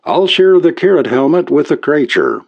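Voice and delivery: high-pitched Irish lilt, resolved; corny Irish brogue. A narrator's reading. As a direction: declare it in a light Irish brogue. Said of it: "pick up the corniest brogue".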